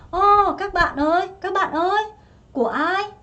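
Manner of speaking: teacher calling out a question; calling out to the class. Acted. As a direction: call to everyone, asking whose it is. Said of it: "이렇게 얘기했어요".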